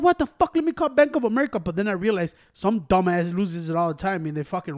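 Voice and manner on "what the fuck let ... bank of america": high-pitched exasperated outburst; mock outraged customer; acted indignation. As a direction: pitch it up in retold disbelief. Acts out reaction to declines.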